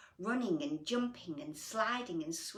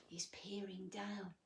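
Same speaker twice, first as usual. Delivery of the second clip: whispering; 10 dB quieter than usual. Real, not acted. Hushed comment on picture.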